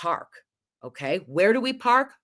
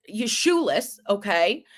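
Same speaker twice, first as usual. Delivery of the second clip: usual speaking voice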